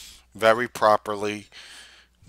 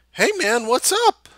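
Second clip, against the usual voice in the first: cheery greeting; 13 semitones higher than usual. Retold or imitated. Greeting the homeless guy cheerfully.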